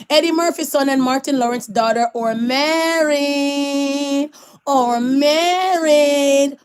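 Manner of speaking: slow stretched announcer read; drawn-out announcement. Acted. Announcing the celebrity wedding, drawn out. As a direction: stretch it like big news.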